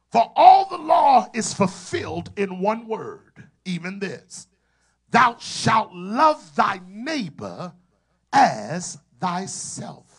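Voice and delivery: slow, loud, high-pitched scripture reading that swells and fades; preaching at full force. Acted. Preacher reading Galatians to his congregation, full preaching force. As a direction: proclaim each phrase like thunder from the pulpit. Repeats the text of emphasis.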